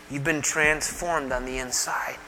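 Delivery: softly and slowly, almost hushed. Acted. Preacher hushing to his congregation, slow and soft. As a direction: drop to a near hush to drive it home.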